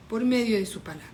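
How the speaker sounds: ordinary talk, nothing marked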